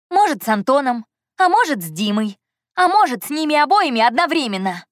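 loud, high, defiant taunt; angry yelling. A narrator's reading. Heroine taunts Andrey before whole restaurant, yelling. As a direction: build to a high, provoking cry. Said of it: "проорала я на весь ресторан".

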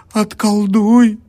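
loud, slow, high desperate plea; pleading. A narrator's reading. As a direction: beg for your soul. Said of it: "взмолилась она". (отчаянная мольба)

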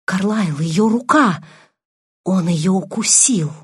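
understated horror, slowing at the end; a touch of dread. A narrator's reading. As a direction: report it aghast. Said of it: "в нём звучал неподдельный ужас".

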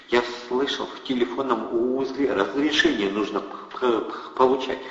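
timid stammer. A narrator's reading. Timid Eddie stammers about permits. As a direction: stutter out the objection.

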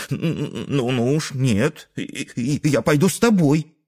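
put-on high, stuttering little boy voice; indignant stammering kid. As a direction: stammer it out like a cross child. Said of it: "возмутился Дрожащий Хвост".

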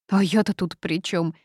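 ordinary delivery, nothing marked

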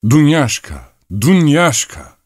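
high, loud call for the servant; sudden full-voiced shout. A narrator's reading. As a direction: yell out the name at full voice. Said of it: "birdenbire olanca sesiyle haykırıverdi".

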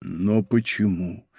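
slow, low, softened plea; drawn-out despairing question. A narrator's reading. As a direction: ask it slowly and low, in despair. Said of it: "с отчаянием в голосе".

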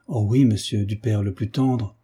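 ordinary delivery

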